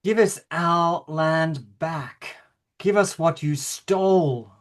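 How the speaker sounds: slow reading, each phrase weighed apart. Shown as a demonstration. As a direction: let every phrase land before the next. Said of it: "slower, listening to, weighing every phrase".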